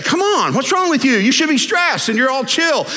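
high-pitched fed-up sister; exasperated Martha. Acted. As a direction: scold your siblings for relaxing while you stress. Acting Martha fed up with siblings.

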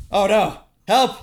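high, loud mock panic; mock cry for help. Dragged in, calling to friends for help in mock panic. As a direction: yelp for help like you're being pulled in.

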